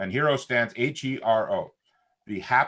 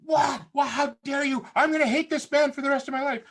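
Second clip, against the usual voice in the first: high-pitched, indignant imitation of onlookers; mock outrage. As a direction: act out a scandalized crowd. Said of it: "some people went".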